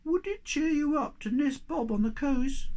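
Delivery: very high squeaky little creature; tiny creature voice. Acted. Thingamy greeting Moomin Troll, high and squeaky. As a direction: squeak it like a tiny odd being.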